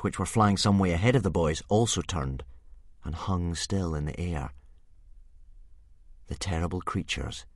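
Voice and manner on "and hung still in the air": usual speaking voice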